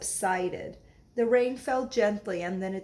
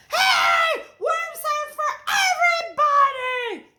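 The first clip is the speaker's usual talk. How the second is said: very high, loud shout; high shouted protest; cried out; 8 dB louder, faster than usual. Acted. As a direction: yell it in protest. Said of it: "they cried".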